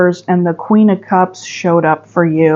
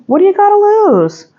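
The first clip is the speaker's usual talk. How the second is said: much higher, exclaimed challenge; emphatic urging; 12 semitones higher than usual. Real, not acted. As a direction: push the question up high, then drop at the end. Urging repeat of her question.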